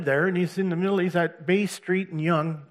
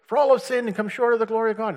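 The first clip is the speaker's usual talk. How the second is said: higher, louder, fast proclamation; street preaching; 8 semitones higher, 5 dB louder, faster than usual. Retold or imitated. Preaching to passers-by downtown, loud and fast. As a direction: call the verse out over traffic. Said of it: "started going".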